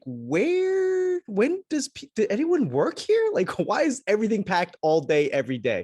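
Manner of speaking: high, squeaky voice of an incredulous local; mock baffled crowd.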